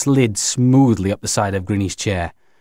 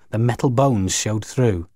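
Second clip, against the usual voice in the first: ordinary delivery, nothing marked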